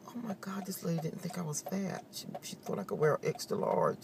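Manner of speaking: awed whisper to herself; whispered thought. Retold or imitated. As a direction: whisper it like a secret you can't believe. Inner thought, shocked disbelief.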